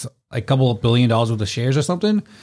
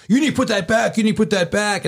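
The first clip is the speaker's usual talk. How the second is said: scolding customers; 9 semitones higher than usual. Retold or imitated. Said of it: "the customers are like".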